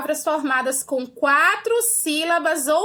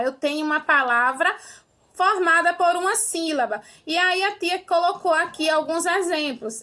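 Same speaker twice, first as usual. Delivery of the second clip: normal talking voice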